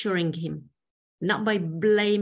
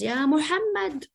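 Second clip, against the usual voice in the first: calling the Prophet by bare name, pitched high; blunt, disrespectful hail of Muhammad; rude bare-name call; 8 semitones higher than usual. Retold or imitated. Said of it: "with his bare name".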